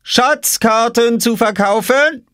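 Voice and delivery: very high, slow questioning call; sly sales call. Acted. Oskar calls to his sister, very high, slow and sly. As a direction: dangle the offer slyly. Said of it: "rief Oskar".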